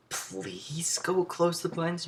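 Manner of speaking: high, drawn-out mock plea; exasperated plea. Teacher asking the student a second time, exasperated plea. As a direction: drag out a strained, pleading request.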